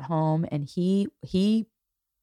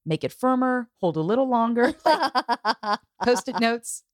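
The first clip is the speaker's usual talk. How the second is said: higher, laughing stage directions; 7 semitones higher than usual. Acted. Mother scripting a hug for her family, laughing. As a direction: giggle through the list of cues.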